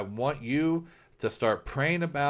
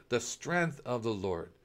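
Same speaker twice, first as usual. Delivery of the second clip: slow, softer repeat, almost to oneself; soft echo; 3 semitones lower, 7 dB quieter than usual. Pretend. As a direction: let it fall away into a hushed echo. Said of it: "no, you will say".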